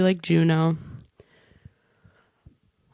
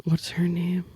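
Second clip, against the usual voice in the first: whispered to herself; slower than usual. Real, not acted. Vlogger forgetting an actress's name, whispering to herself. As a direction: whisper it to yourself mid-thought.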